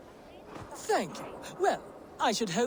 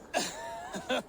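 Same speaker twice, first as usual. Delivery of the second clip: airy amused laugh; breathy gleeful chuckle; delighted laugh; 5 semitones higher than usual. Acted. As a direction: laugh with relish at the rival's name. Trader laughs at the name.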